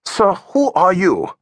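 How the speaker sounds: slow, deliberate asking; slowly pressing the young man; pointed question. A narrator's reading. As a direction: press him, word by word. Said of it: "Augie asked".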